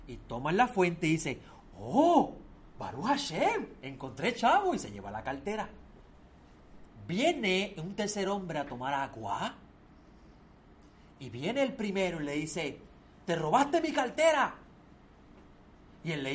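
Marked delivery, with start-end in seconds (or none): 1.8-4.7 high, excited exclamation of luck; delighted find. Acted. Second man finds the wallet, high and delighted. 13.2-14.5 high, loud furious accusation; angry accusation. Acted. Robbed man accuses the third man, high and furious.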